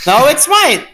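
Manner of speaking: put-on childish squabble. Squabbling over book.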